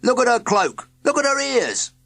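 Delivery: loud, rising shout; shoddy shouting. A narrator's reading. Minion accusing Heathcliff in a loud shout. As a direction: jab each point with a shout. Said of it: "the shoddy shouted".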